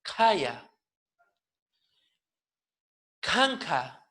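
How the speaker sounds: "Kaya", "Kanka" with breathy K; aspirated K. Shown as a demonstration. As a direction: release each K with a puff of air. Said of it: "no es simple".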